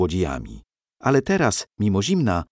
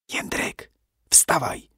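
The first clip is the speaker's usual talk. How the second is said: urgent hissed whisper; whisper yelling; 6 semitones higher, slower than usual. Acted. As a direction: hiss it, urgent and hushed. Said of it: "syknęła".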